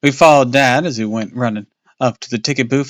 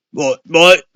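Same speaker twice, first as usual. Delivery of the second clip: louder, higher sputter; 7 semitones higher, 8 dB louder than usual. Acted. Father sputtering at the monster host. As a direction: choke on the words. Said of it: "Dad sputtered".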